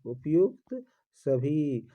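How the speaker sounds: उपयुक्त सभी drawn out slowly, weight on उपयुक्त; slowly stamp उपयुक्त सभी as the answer; slow emphatic answer. Shown as a demonstration. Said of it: "teacher repeats the answer slowly". (उपयुक्त सभी धीरे और ज़ोर से)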